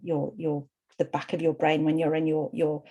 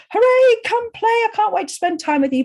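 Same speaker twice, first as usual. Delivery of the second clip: much higher and louder, bursting with glee; excited little girl; 14 semitones higher, 7 dB louder than usual. Retold or imitated. Little daughter greeting dad home from the office, high loud excitement. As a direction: squeal it like a small child running to her dad.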